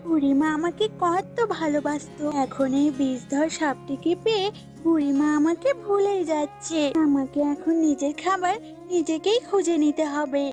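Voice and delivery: lightly voiced goat, feeling left out; neglected goat. Goat alone, missing the old woman's love, mildly aggrieved. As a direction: voice a goat grumbling to itself.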